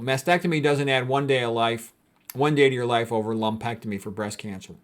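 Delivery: ordinary talk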